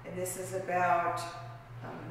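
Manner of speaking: normal talking voice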